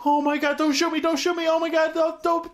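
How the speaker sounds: high, loud, fast pleading in fake terror; hammed-up frightened hostage act; mock panic. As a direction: beg for your life, badly faking panic. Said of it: "he's pretending John's the terrorist with his fucking American accent".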